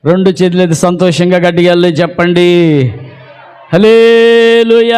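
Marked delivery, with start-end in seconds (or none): 3.7-5.0 1 loud hallelujah. Real, not acted. Preacher leads congregation's hallelujah.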